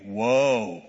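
woe said as whoa, a drawn-out stop call; read as whoa. Shown as a demonstration. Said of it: "I read it W H O A".

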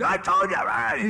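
put-on drawn-out, exasperated yell at a child; acted parental yelling. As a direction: yell it slowly at the top of your lungs. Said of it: "And he starts yelling".

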